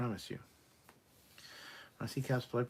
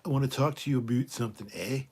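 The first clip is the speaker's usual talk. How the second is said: louder, higher, quick Canadian caricature; mock Canadian; 4 semitones higher, 9 dB louder than usual. Showing how a Canadian would be done, mock Canadian accent. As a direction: put on a cartoon Canadian accent. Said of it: "how would you do a Canadian".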